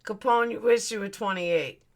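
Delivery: ordinary delivery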